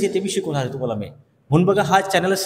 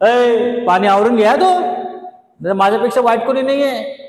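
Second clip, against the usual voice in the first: louder, higher quarrel shout; angry at neighbour; 5 semitones higher, 5 dB louder than usual. Shown as a demonstration. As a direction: warn the neighbour off sharply. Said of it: "तुम्ही काय म्हणणार?".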